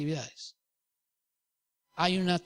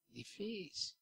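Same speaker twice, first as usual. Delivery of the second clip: hushed aside; 4 semitones higher, 18 dB quieter, much slower than usual. Acted. Quiet aside after a point.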